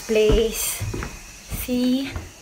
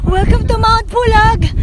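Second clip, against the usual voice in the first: loud, high joking welcome; shouting the joke from the top of the hill; triumphant announcement; 10 semitones higher, 10 dB louder than usual. Real, not acted. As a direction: proclaim it like you conquered a mountain.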